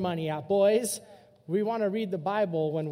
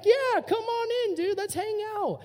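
much higher, slow, goofy king voice; high silly voice inviting him in; king's welcome; 12 semitones higher than usual. Retold or imitated. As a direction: play the clueless king, all friendly. King welcomes assassin inside.

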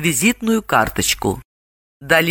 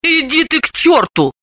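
higher, irritated retort; mildly angry comeback; snapping back; 11 semitones higher than usual. A narrator's reading. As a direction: brush off your friend crossly. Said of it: "огрызнулась Далила".

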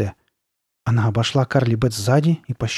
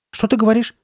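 high, bewildered question; puzzled question; 10 semitones higher, 3 dB louder than usual. A narrator's reading. Sabrina baffled by her friend, puzzled. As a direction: ask it pitched up in confusion. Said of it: "не поняла Сабрина".

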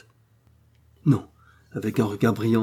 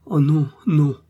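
higher, louder anguished cry; a hammed-up anguished wail, higher and louder; terrible anguish; 6 semitones higher, 6 dB louder, slower than usual. A narrator's reading. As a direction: cry out in despair at the door. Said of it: "d'un ton de terrible angoisse".

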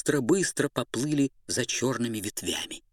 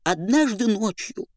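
high Pierrot voice; 10 semitones higher, 3 dB louder than usual. Acted. Pierrot tells story.

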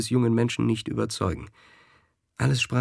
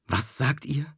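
a higher, shocked cry of disbelief; horrified cry; 5 semitones higher than usual. A narrator's reading. The Scuderie crying out at the president's accusation. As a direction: gasp the question out in horror. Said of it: "schrie die Scuderie".

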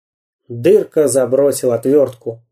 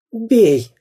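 higher, goading cry; egging on; 8 semitones higher than usual. A narrator's reading. Spy Dyrka eggs on the pirate, goading cry. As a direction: urge the pirate to strike. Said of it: "наускивал Дырка".

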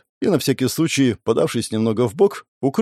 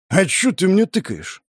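bristling thug; 6 semitones higher than usual. A narrator's reading. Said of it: "Моментально зацепился за слова набычившийся мужик".

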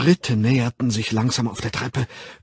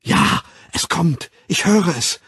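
fervent raised-voice outburst; ecstatic cry; 6 semitones higher, 6 dB louder than usual. A narrator's reading. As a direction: cry it out, swept up in frenzy. Ecstatic worshippers joining the cries.

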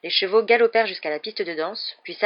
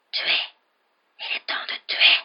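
cold, deadly voice. A narrator's reading. Unseen voice in the passage, deadly whispering. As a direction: whisper each word with cold menace. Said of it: "la même voix froide et mortelle".

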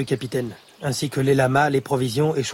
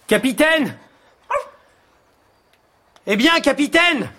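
high call across the camp; 10 semitones higher, slower than usual. Acted. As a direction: call out to find him. Calls for missing companion.

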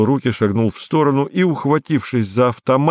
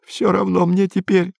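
high, whimpering mumble; thin, high-pitched sniveling voice; tearful muttering; 9 semitones higher than usual. A narrator's reading. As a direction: mutter hopelessly as if crying. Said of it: "забормотал он".

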